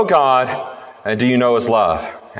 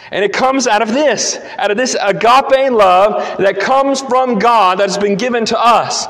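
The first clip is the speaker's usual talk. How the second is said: raised, insistent sermon voice; emphatic preaching; 7 semitones higher than usual. Real, not acted. Preacher telling the congregation love comes from God, emphatic preaching. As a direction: preach it with conviction, pitched up high.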